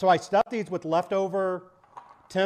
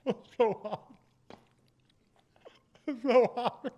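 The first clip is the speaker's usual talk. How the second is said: high, through a scalding mouthful; mouth full of hot food; 7 semitones higher, slower than usual. Real, not acted. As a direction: talk past a burning bite. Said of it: "steam coming out of your mouth".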